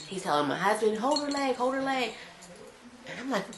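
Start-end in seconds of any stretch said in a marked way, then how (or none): 1.0-2.1 urgent. Retold or imitated. Doctor urgent during delivery.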